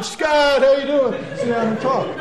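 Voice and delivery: loud, high hearty greeting across a bar; friendly shout. Retold or imitated. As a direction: call out like an old buddy. Legionnaires greeting him.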